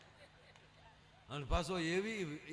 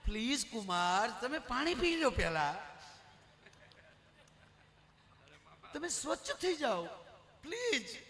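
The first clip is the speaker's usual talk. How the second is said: pleading mother-in-law; 3 semitones higher than usual. Retold or imitated. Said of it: "પંદર દી પહેલા".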